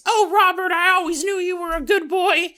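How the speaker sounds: high sweet mother voice; creepy mother voice. Acted. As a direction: coo like a monstrous mom. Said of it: "still using his mother's voice".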